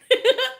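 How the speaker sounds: short high squeal of a laugh; burst of laughter. Real, not acted. Laughing at the man's confession, real high burst of laughter. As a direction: let out a sudden high laugh. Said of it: "I fucking laughed".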